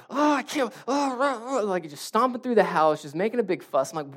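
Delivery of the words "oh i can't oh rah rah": high, whiny mock tantrum; imitated frustrated fussing. Dad imitating his frustrated kids' noises, mock tantrum. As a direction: grumble and roar like a thwarted kid. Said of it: "they're making these noises like".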